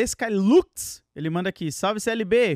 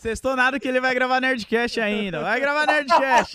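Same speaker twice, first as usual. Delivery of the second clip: teasing; 6 semitones higher, 4 dB louder, slower than usual. Real, not acted. Teasing reply to the Friday cheer.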